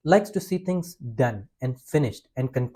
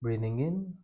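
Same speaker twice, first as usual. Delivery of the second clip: guided breathing; 3 semitones lower, 7 dB quieter than usual. Acted. Leads a breathing practice.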